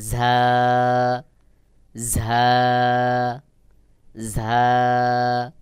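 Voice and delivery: ঝা long, with air buzzing outward. Shown as a demonstration. Teacher repeats the letter for learners, airy drawn-out ঝা. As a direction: keep breath flowing through a held ঝা. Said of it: "বাহিরের দিকে অবশ্যই বাতাস বের হবে".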